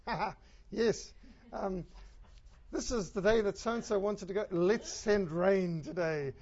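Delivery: loud, slow gloating voice; mocking cosmic villain, drawn out; mock gloating God. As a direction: ham up a spiteful God planning rain. God mocked as cosmic conspirator.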